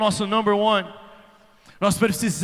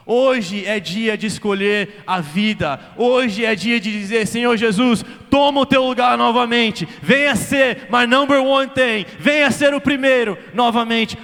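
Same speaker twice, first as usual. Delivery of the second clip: rising prayer; 3 semitones higher, 6 dB louder, slower than usual. Acted. Preacher voicing a prayer, louder.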